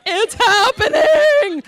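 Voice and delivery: high, loud, drawn-out wail; movie flashback outburst. Retold or imitated. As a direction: holler it slowly in hammed-up panic. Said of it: "Sorry, flashback".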